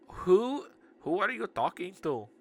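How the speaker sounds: high, strong put-on Indian accent. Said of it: "I'm gonna give her Indian voice".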